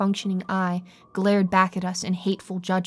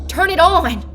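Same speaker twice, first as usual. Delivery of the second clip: much higher, louder order; commanding shout; 9 semitones higher, 9 dB louder than usual. A narrator's reading. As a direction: bark the command. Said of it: "she commanded me".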